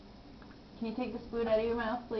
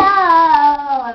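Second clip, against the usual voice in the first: loud and slow; 2 semitones higher, 15 dB louder than usual. Real, not acted. Mother urging child to count.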